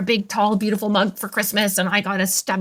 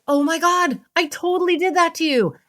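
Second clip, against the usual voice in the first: mirroring husband; 9 semitones higher than usual. Retold or imitated. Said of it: "he's like".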